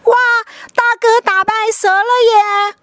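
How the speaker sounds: high, loud happy cheer; excited cheering. Acted. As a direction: cheer the big brother on. Brothers cheer.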